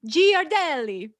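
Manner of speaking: a mock dashing Italian man introducing himself in an Italian accent. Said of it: "I cannot do two Italian accents".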